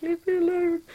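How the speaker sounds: loud mock-exasperated whine; exasperated plea. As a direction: shoo off the fans, mock-annoyed. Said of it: "I'm just like".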